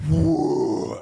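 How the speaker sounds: high, loud drawn-out whoa; mock amazed whoa. Scooping a mega portion, amazed whoa. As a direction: marvel at the giant scoop. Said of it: "I'm gonna go".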